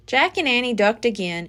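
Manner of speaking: ordinary delivery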